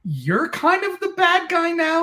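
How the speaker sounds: high, loud, fast disbelief; stunned, what happened here; baffled surprise. Retold or imitated. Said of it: "I'm still just like".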